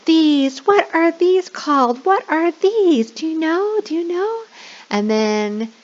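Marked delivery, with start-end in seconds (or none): 0.0-4.4 much higher, slow, sing-song quizzing; high, slow, playful teacher voice to little kids; animated teacher question. Shown as a demonstration. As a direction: draw the kids in with exaggerated curiosity. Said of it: "So we're gonna say".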